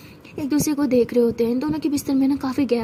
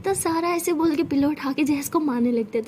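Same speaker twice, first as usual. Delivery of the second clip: ordinary delivery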